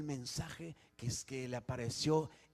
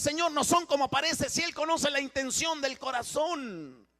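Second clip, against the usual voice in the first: raised, ringing pulpit voice, pressing each question; fiery preaching; 7 semitones higher, 7 dB louder than usual. Acted. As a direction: preach it out to the congregation. Preaching at full force.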